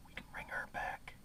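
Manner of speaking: barely audible whisper; whispered appeal. Real, not acted. Urging viewers to save the American dream, whispered. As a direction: drop right down to a whisper for the plea.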